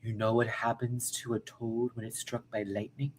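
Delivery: a hint of an African accent, quiet; understated African accent impression; mock Storm line. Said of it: "you gotta do her African accent".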